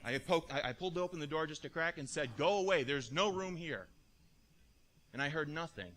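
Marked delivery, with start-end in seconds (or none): 2.4-3.9 raised voice, slow and firm; louder, spaced-out dismissal; curt turning away. Acted. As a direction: send them off loudly through the door. Innkeeper turning callers away at door.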